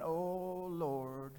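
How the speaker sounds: long, soft "oh Lord" under the breath; retold uneasy little prayer; apprehensive aside. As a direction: draw it out quietly, dreading what's ahead. Dreading approach to three strange men.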